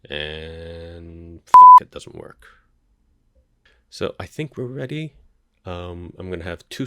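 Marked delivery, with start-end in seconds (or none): 0.1-1.8 frustrated curse. Real, not acted. Capture fails to start. 1.8-2.5 whispered mutter. Real, not acted. Muttering after failed setup.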